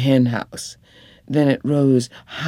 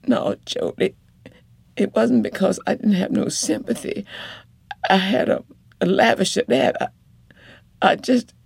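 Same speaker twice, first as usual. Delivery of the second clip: much higher, urgent woman's voice; pleading wife; 9 semitones higher, faster than usual. Acted. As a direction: pour it out, begging him to understand.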